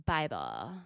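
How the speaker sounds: "Bible." dropped very low; deadpan low "Bible." echo; low deadpan repeat. Shown as a demonstration. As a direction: echo "Bible." deep with a rise.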